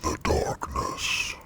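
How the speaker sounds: slow, whispered demon growl; demonic layered voice. Acted. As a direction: intone it like a demon from the dark. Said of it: "talking in a demon voice".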